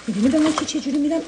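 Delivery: ordinary talk, nothing marked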